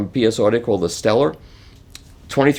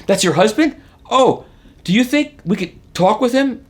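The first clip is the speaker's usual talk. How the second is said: excited fan; 11 semitones higher than usual. Retold or imitated. Excited fan at wife's job.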